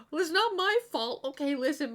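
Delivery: high, loud protest of innocence; mock defensive. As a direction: playfully defend yourself, voice shooting up. Mock defends herself against imagined judgment.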